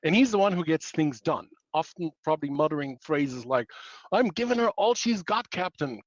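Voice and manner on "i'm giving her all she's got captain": hammed-up bad Scottish accent, higher at the start; a clumsy stab at a Scottish accent, ship's engineer under strain; Scotty's terrible Scottish accent. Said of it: "God, that was a terrible Scottish accent".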